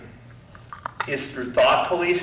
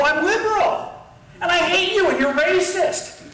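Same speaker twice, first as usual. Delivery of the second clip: mock liberal proclaiming; 13 semitones higher than usual. Liberals proclaiming from the mountaintop, mock shout.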